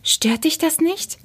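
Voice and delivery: high-pitched, shy question; sheepish little query; embarrassed. A narrator's reading. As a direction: ask timidly, unsure of approval. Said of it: "Hopsi verschränkte verlegen die Pfoten".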